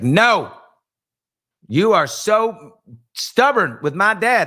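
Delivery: hammed-up angry wife voice; mock ex-wife. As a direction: imitate the ex-wife refusing, drawn out and shrill. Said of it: "And she's like".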